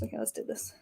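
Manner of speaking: whispered. Real, not acted. Muttering to herself.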